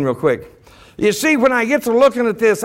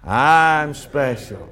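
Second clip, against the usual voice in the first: very slow, lower lead for the crowd; slow, measured call to repeat; drawn-out cue; 6 semitones lower, much slower than usual. Shown as a demonstration. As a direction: stretch each word for everyone to join.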